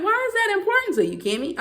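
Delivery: very high-pitched, incredulous question; acted-out puzzled challenge, dropping on the name; retold baffled challenge. That's what really threw it.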